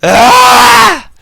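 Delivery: sudden high, loud yelp; frustrated scream. Real, not acted. Player screaming as the game rolls back, frustrated scream. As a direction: yell out at the game.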